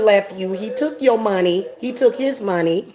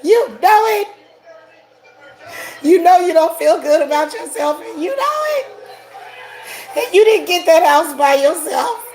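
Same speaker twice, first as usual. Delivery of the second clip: taunting, building to shout; 6 semitones higher than usual. Real, not acted. Mocking a rival witch, building to a taunting yell. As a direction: hammer every you know it until it breaks into a yell.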